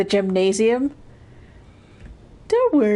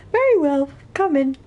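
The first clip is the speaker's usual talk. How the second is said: higher, polite caretaker voice; handyman character voice; 10 semitones higher than usual. Acted. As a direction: invite them in as the caretaker.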